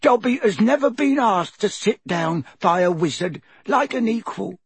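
high, overwhelmed elf voice; choked up. A narrator's reading. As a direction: blurt it out squeakily, close to tears. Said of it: "choked the elf".

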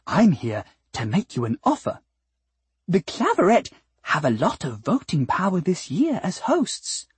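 high, swooping, honeyed sales pitch; wheedling sweet flower. Acted. Flower offering the cat votes for a fee, high and wheedling. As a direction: coax sweetly, soaring on key phrases.

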